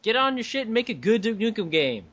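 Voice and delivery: high-pitched playful scolding; mock-angry demand. Podcaster echoing friend's order to game makers, mock outrage. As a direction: echo the rant in mock exasperation.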